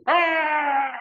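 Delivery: high, sustained mock scream; mock wail. Acting out hearing that radio voice, high mock wail. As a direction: hold one high comic wail.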